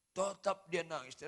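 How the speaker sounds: normal talking voice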